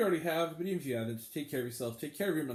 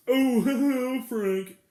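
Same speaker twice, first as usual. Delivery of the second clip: higher, louder, chuckling mock voice; mock laughing moviegoer; 8 semitones higher, 9 dB louder than usual. Host mimics a rude audience member laughing, mocking. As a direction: mimic a clueless viewer chortling.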